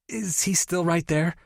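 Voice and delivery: overexcited friend teasing about the stepbrother; gushing best friend. Acted. Tori asking nervously.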